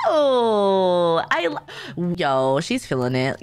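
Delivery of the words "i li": ordinary talk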